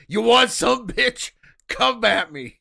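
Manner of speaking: hammed-up high yell, daring a fight; Don the Dragon Wilson. Kickboxer challenging his sparring partner, comic shouted taunt. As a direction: bellow the taunt like a riled-up fighter. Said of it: "Don the Dragon Wilson was up here like".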